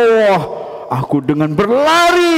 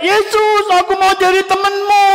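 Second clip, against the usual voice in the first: shouting; 11 semitones higher than usual. Pretend. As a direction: call out from the treetop. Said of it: "aku mau teriak". (berteriak dari atas pohon)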